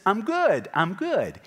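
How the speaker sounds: high, loud smug "I'm good"; mock self-assured claim. Preacher imitating the rich young man, smug and loud. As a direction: play the proud young man brushing it off.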